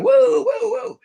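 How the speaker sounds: very high, alarmed whoas; startled whoa. Retold or imitated. At the inquiry hearing his name, cutting in high and alarmed. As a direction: jump in to stop the accusation.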